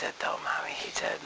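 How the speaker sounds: whispered aside to her mom. Real, not acted. Daughter whispering to her mother in the audience. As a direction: breathe it quietly to mommy.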